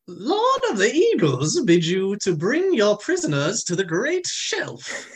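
high, slow shrieking giant bird; a lordly eagle messenger, shrill and slow. Acted. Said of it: "I'm gonna go a little shrill with it".

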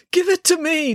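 high-pitched, insistent demand. A narrator's reading. Dad wrestles the DJ for the microphone, demanding. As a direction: insist on having it. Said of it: "demanded Dad".

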